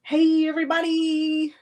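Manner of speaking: high, sing-song hello; the trademark greeting; signature greeting. Shown as a demonstration. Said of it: "hyper when he hears me say".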